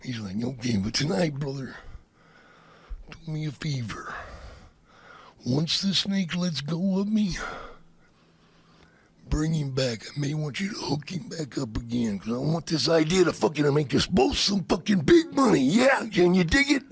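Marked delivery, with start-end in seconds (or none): 0.4-12.2 low, quiet, deliberate wrestler; Macho Man. Retold or imitated. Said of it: "That's a great Macho Man impression". 12.2-16.8 wrestler promo building to a catchphrase; Macho Man. Retold or imitated. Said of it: "That's a great Macho Man impression".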